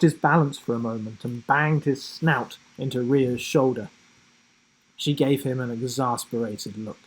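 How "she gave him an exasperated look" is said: usual speaking voice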